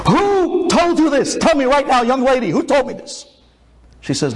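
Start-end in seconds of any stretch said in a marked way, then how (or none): 0.1-3.2 high-pitched, agitated interrogation; urgent angry demand. Acted. Rabbi presses young lady for a name, agitated. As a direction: demand an answer, pitched right up.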